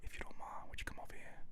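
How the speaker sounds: barely audible whispered come-on; mock seductive whisper. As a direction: imitate a whispering lover, far too quiet. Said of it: "you talking about some".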